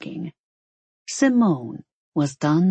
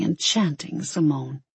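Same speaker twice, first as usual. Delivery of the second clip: plain reading voice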